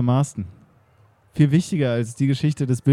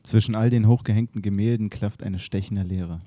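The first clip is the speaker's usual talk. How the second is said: radio play narration; 4 semitones lower than usual. A narrator's reading. Narrator setting the museum scene, low and hushed.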